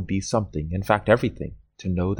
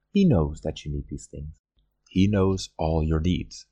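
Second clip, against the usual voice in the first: ordinary delivery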